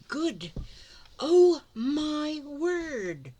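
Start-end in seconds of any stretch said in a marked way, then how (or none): 1.2-3.3 amazed. Real, not acted. Opening a gift, amazed.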